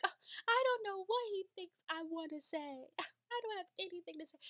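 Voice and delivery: high, fast, laughing reply; giddy laughing Mary. Retold or imitated. Host imitating Mary laughing and giddy. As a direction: giggle through it, flattered. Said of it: "laughing, like".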